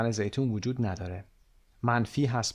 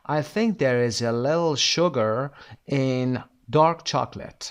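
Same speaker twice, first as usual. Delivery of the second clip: slow full sentence; much slower than usual. Shown as a demonstration.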